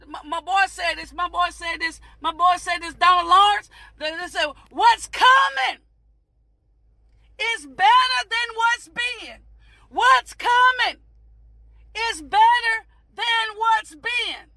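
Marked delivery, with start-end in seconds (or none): 4.7-14.5 stretched words with weighty pauses; slow, emphatic quote. Retold or imitated. Preacher quoting [name]'s line, slow and emphatic. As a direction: proclaim it phrase by phrase, slowly.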